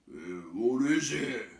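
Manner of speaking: puzzled troll. Acted. The other trolls coming up, puzzled troll. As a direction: ask it dim and slow.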